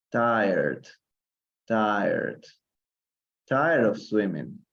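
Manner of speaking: "Tired" said as one syllable, no E sound; "tired" like "tyrd", silent E, slowly; pronunciation demo. Shown as a demonstration. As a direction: model "Tired" with the E dropped. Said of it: "la E no, normalmente no suena aquí".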